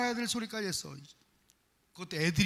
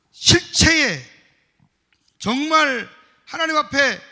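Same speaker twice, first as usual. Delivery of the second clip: higher, much louder, slow; climactic preaching; 6 semitones higher, 11 dB louder, slower than usual. Real, not acted. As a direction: build it to a slow loud peak. (절정에서 높고 크게 천천히 외치듯)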